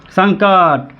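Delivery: usual speaking voice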